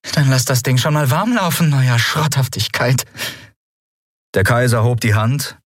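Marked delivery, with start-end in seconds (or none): 0.1-3.0 high-pitched defiant bravado; cheeky taunt. Acted. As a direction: goad the captor impudently. Gefangene provoziert frech den Kaiser. (frech und spöttisch, mit hoher Stimme)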